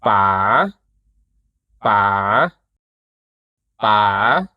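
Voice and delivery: PA held low, then swept upward; rising tone. Shown as a demonstration. Teacher drilling the rising tone, long low then rising. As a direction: hold "pa" low longer, then lift the end. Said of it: "but hold it a bit longer".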